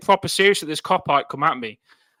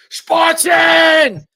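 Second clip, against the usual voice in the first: slow, drawn-out roar, much higher and louder; Spartan battle cry; 13 semitones higher, 5 dB louder, much slower than usual. Pretend. Fan in helmet roaring at the camera, slow epic battle cry. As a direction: bellow it like a movie Spartan.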